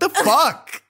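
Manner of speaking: incredulous laugh. Real, not acted.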